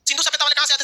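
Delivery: normal talking voice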